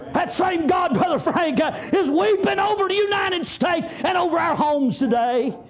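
rising, shouted preaching; rising shout from the pulpit; preaching at full force. Acted. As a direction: lift the congregation with a rising, shouted cry.

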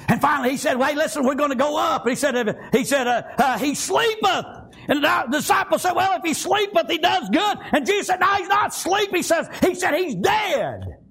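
Bible story preached with conviction; fervent preaching. Real, not acted. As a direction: drive the story home, stretching the key words.

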